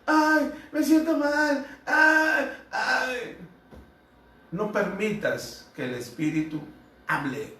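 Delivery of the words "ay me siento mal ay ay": much higher, whiny fake moaning; faking demon. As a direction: moan like a demon pretending to suffer. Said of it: "esto lo hacen para engañar". (demonio fingiendo dolor, voz aguda y quejumbrosa)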